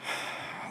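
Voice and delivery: voiceless, shaky sigh out. Real, not acted. After recalling the panic attack, a real shaky sigh. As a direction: breathe it out like the weight is leaving.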